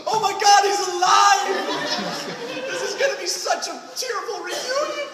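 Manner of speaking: a hammed-up squeal of joy, high and loud; mock excited gush. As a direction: overplay a teary reunion. Mocking audience reaction to dad.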